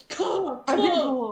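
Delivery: high croaky raptor call; a hoarse old-smoker creature call; velociraptor call. Retold or imitated. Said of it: "my Velociraptor call mostly sounds like an old smoker".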